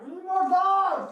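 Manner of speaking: loud, slow, high yell; shouting. Real, not acted. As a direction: yell it across the room. Calling for reinforcements.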